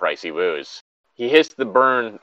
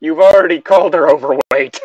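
louder, higher teasing jab; laughing tease; 5 semitones higher, 8 dB louder than usual. Real, not acted. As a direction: call him out between laughs. Teasing friend loudly.

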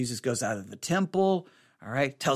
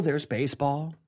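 ordinary delivery

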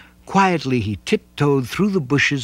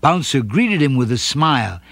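ordinary delivery

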